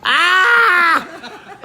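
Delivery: loud high squeal; delighted shriek. Real, not acted. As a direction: shriek with joy. Reacts to a viewer's answer.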